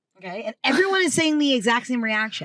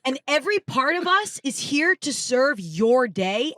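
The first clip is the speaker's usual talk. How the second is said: slow, higher-pitched solemn proclamation; imitating an earnest stranger, drawn-out and lifted; stranger's proclamation; 5 semitones higher, slower than usual. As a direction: declare it slowly, stretching every phrase like a grand prophecy. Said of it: "a woman in the crowd comes up to me and goes".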